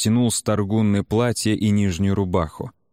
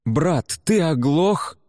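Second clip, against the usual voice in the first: high-pitched indignant outburst; flaring up; 8 semitones higher, much slower than usual. A narrator's reading. Bjarki confronts his brother, flaring up. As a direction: flare up at your brother. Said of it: "вспыхнул Бьярки".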